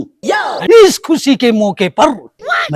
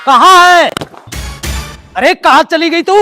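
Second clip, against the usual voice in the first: raised, high-pitched call; impatient summons through the house; 6 semitones higher than usual. Acted. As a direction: holler for someone out of sight. Calling out for the wife.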